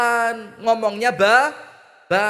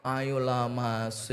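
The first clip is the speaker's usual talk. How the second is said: slow, soft sweet coaxing; a wife softly coaxing her husband, slow and sweet; 7 semitones lower, 8 dB quieter, much slower than usual. Acted. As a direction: woo him gently, drawing the words out. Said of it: "ngomongnya ngerayu".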